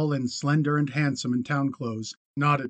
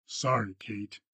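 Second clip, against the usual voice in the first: low, soft apology, much quieter; softly; 5 semitones lower, 6 dB quieter, slower than usual. A narrator's reading. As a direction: say it gently, low. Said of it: "he said softly".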